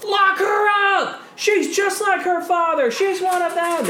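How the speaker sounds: very high, loud mob shout; furious heckler yelling an accusation; angry crowd yell. Acted. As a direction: scream it from the back of the crowd. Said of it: "you hear someone yell".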